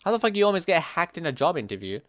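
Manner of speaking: ordinary talk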